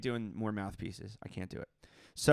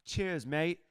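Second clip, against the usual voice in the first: higher, playful mock British; pretend British accent; 5 semitones higher than usual. Greeting a fellow player, pretend British accent. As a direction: toss it off in a put-on British accent. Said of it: "pretend to make a British accent".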